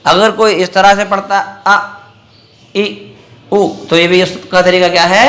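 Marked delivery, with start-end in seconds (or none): 1.7-3.7 आ, ई, ऊ each cut short with a jerk; wrongly jerked vowels. Shown as a demonstration. Teacher showing a mistake, jerked आ ई ऊ. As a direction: jolt आ, ई, ऊ out abruptly. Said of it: "बगैर झटका दिए".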